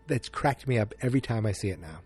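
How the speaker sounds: normal talking voice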